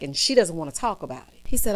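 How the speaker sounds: ordinary delivery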